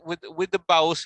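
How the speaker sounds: ordinary talk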